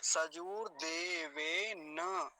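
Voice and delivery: सजूर देवेन very slowly, ending "de-ve-na"; slow model सजूर देवेन, long vowels, open final na; final vowel sounded. Shown as a demonstration. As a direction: stretch सजूर देवेन, full a after the last n. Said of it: "तो क्या बोलेंगे?".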